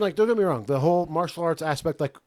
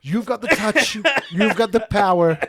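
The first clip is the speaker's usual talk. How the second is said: hammed-up villain; 3 semitones higher than usual. Hammy villain pitch to Bond.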